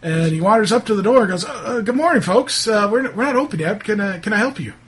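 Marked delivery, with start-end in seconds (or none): 1.4-4.7 higher-pitched, hesitant shopkeeper greeting; flustered shopkeeper. Acted. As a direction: politely stall early customers, stammering a little.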